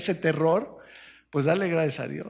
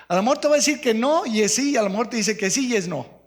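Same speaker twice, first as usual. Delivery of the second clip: faster, louder teasing; playful; 6 semitones higher, 6 dB louder, faster than usual. Real, not acted. Pastor jokes with the congregation, playful. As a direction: tease them. Joke about answers.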